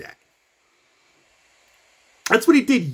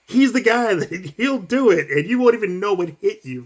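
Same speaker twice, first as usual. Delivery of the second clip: ordinary talk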